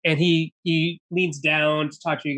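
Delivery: ordinary talk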